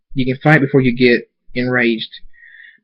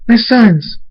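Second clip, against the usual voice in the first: high, loud joyful exclamation; overjoyed mom; excited cry; 11 semitones higher, 5 dB louder than usual. Acted. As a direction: burst out with delight at seeing them. Mother greeting her sons.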